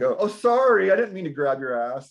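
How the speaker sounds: hammed-up sleazy apology; mock groper apology. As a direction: ham up a guy pretending it was an accident.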